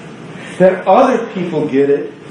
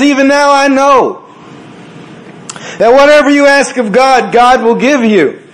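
higher and louder, pressing each word; Martha hoping; 6 semitones higher, 4 dB louder than usual. Acted. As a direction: insist with anguished hope. Preacher voices Martha's hope.

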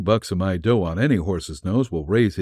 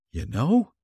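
a little tense; 7 semitones higher than usual. A narrator's reading. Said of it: "he said a little tensely".

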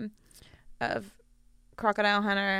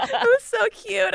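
normal talking voice

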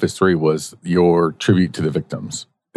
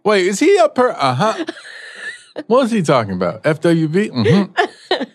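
giggly mock reaction; 12 semitones higher than usual. Mock viewer reacting to show.

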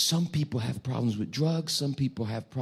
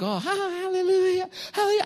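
much higher, chirpy hallelujah; mock cheering. Congregation cheering, high mock hallelujah. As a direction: parody of eager churchgoers.

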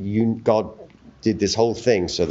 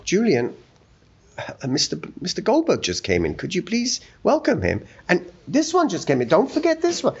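high-pitched imitation of a bustling hostess; high voice, busily directing the welcome; imitating mother; 8 semitones higher than usual. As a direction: play a mother giving orders, pitched well up. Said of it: "and she's going".

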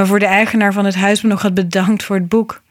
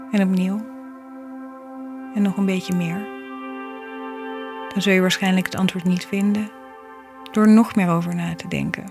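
soft spa whisper; 5 dB quieter than usual. Acted. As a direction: read it gently like a calming mantra. Reflective poem read over music.

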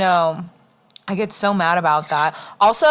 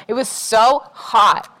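drawn-out complaint; 3 semitones higher, slower than usual. Real, not acted. Complaining about the heat.

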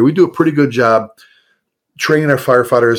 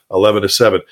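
ordinary talk, nothing marked

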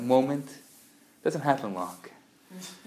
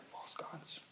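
shocked whisper; 23 dB quieter than usual. Retold or imitated.

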